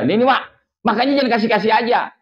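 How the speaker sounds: high, loud half-scolding; sibling scolding mother. Retold or imitated. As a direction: hand it back with a scold. Returns ring, scolds mother.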